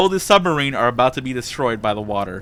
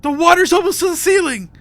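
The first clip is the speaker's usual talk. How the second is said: high, loud cry of alarm; panicked screaming; 7 dB louder than usual. Acted. Captain screams through the PA as water rises, panicked shouting. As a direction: yell the warning as if about to drown. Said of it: "He's just screaming".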